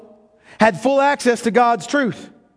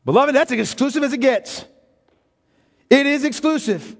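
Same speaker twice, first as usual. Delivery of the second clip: ordinary talk, nothing marked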